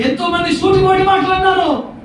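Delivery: high, shouted lament; impassioned preaching. Real, not acted. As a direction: cry out each item to the crowd. Preacher listing insults suffered. (ఆవేదనతో అరుస్తూ బోధ)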